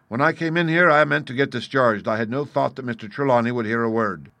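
emphatic outburst of candour; blunt captain, owning up. Acted. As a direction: speak up frankly as the captain. Captain admits his real intent.

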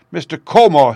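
high, loud outraged shout; angry cry. Acted. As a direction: shout the name in sudden fury. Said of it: "he cried".

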